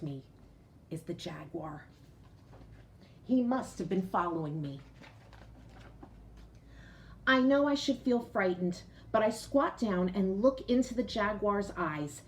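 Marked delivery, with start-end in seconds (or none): none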